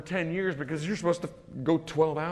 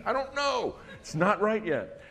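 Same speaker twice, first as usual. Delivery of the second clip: much higher, slow drawn-out brush-off; evasive dodge; 8 semitones higher, slower than usual. Retold or imitated. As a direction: stall her, stretching the words. Evasive reply to wife.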